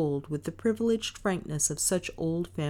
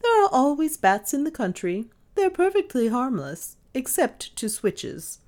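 high, emphatic reassurance, slowing for the jibe; amused dismissal; 11 semitones higher, 5 dB louder than usual. A narrator's reading. Mistress teasing maid about harmless bats, breezily dismissive. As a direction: brush the fear aside, then tease. Mistress brushes off maid's fear.

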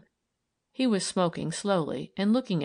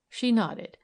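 ordinary delivery

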